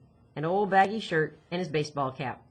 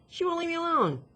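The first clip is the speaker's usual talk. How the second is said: high-pitched, aggrieved protest; put-upon girl complaining; exasperated complaint; 12 semitones higher than usual. Acted. Complaint in acted dialogue.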